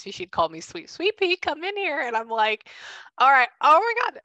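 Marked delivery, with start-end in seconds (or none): 0.6-2.0 much higher, sing-song call of a mother; imitating mom calling. Her mom calling her in from the next room, much higher. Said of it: "made me hear my mom's voice". 3.2-4.2 mock exasperated reply. Her reply as a child.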